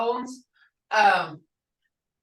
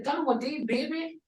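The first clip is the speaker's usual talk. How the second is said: put-on soft, high "Don, what did, baby?"; grandma softly asking the hurt granddaughter; tender concern; 7 semitones higher, 4 dB quieter than usual. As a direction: ask gently like a worried grandma. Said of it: "Hear that?".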